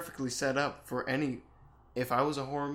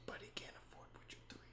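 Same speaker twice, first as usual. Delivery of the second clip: very soft, quick whisper; hushed teasing stage whisper; whispered aside; 20 dB quieter, much faster than usual. Pretend. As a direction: whisper it fast as a sneaky aside.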